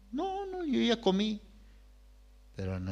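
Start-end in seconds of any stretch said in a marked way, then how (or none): 0.1-1.4 much higher but softer selfless reply; self-denying mother, high and soft; mother's gentle denial. Retold or imitated. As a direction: brush off the child's concern. Mother denies being hungry.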